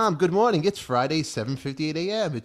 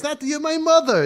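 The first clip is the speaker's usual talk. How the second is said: high loud protest; 14 semitones higher, 7 dB louder than usual. Real, not acted. Son pleads with mom on air.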